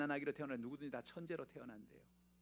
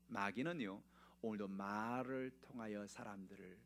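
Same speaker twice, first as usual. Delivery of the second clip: usual speaking voice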